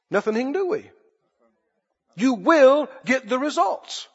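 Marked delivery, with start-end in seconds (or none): none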